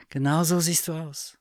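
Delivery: a hint of a sardonic cat; lightly voiced dry cat. Cat mocks the bear's name, lightly dry. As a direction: tease him dryly. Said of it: "sagte die Katze".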